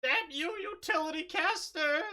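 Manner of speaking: high cry. Acted. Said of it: "they cry".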